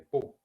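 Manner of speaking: "pu" starting like Spanish puerto; P no aspirada. Shown as a demonstration. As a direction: say "pu" with a crisp P and no puff of air. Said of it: "Como puerto". ("pu" con P sin aspirar)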